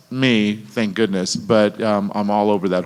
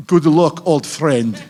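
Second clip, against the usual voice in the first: imitating a really bad Russian accent, higher at the start; 4 semitones higher, slower than usual. Actor mimicking a control tower guy, bad Russian accent. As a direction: put on a hammy Russian accent. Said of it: "doing this really bad Russian accent".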